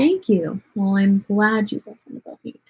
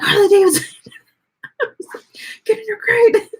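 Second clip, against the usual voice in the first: pitched-up yell, cracking with a laugh; exasperated yell; 11 semitones higher than usual. Retold or imitated. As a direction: shout it at the stubborn dog. Dog ignores her, she yells.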